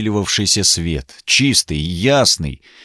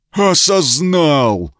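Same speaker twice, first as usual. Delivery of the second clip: beastly echo; 7 semitones higher, much slower than usual. Acted. Repeats as half-beast.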